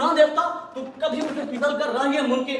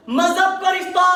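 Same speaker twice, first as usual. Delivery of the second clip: high, booming declaration; showman's ringing cry; loud proclamation; 5 semitones higher, 7 dB louder than usual. Acted. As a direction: proclaim it like a rousing slogan. Showman proclaims answer to own question.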